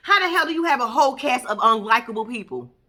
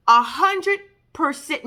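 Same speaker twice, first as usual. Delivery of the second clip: ordinary talk, nothing marked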